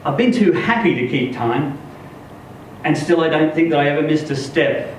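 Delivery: plain reading voice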